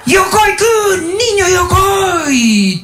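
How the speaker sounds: llamada larguísima, muy aguda y sostenida; grito imitado. Retold or imitated. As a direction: grita el nombre alargándolo, como maestro exaltado. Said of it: "no puede ser que vaya a gritar". (alarido agudo y estirado del maestro)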